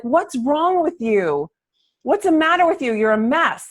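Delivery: mild mock yell of an angry dad; a hint of a dad shouting at a three-year-old; retold dad's yell. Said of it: "my dad came screaming out".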